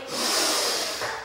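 long forceful breathy exhale; lion breath. Shown as a demonstration. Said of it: "Let's try our lion pose".

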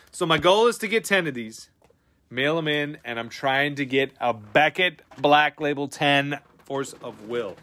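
normal talking voice

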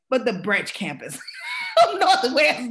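loud, breaking into laughter on campus; laughing exclamation. Retold or imitated. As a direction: laugh your way through the punchline. Daughter's quote dissolving into laughter.

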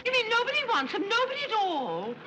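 high-pitched incredulous question; astonished. Acted. Woman reacting to news that nobody wants him, astonished. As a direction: blurt it high, in disbelief.

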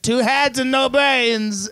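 high, very drawn-out imitation of griping; mock boomer rant. Clerk showing a customer the screen, mock ranting.

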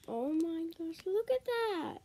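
high, delighted gasp of wonder; excited. Real, not acted. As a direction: squeal at the cute candy. Sees the fish-shaped candy.

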